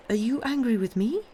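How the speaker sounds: high, rising anxious question; worried asking. A narrator's reading. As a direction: ask as if afraid of the answer. Granddaughter fears he is angry.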